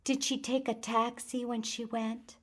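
put-on a little girl who won't let it go; small persistent voice. A narrator's reading. Felicia pushing the woman to go on, small persistent child voice. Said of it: "in the small, persistent voice".

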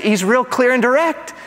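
usual speaking voice

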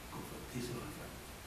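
breathy whisper, almost voiceless; whispered repeat. Shown as a demonstration. Preacher repeats it to the congregation in a hushed whisper. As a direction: whisper "Convertirse los jefes a Cristo" so it sinks in. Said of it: "repeated as a whisper".

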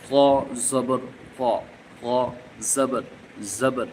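ক voiced thick and heavy from the tongue tip, each syllable set apart; heavy letter drill. Shown as a demonstration. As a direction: Quran teacher modeling each vowel on ক. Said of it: "ক একটু মোটা হরফ".

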